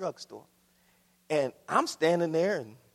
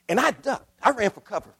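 usual speaking voice

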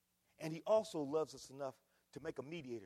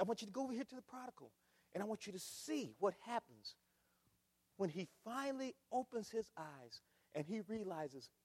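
normal talking voice

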